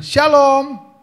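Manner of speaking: high, loud greeting shout; hearty congregation response. Retold or imitated. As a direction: call it out like a lively congregation. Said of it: "Di sini apa responnya?". (seruan jemaat yang kencang)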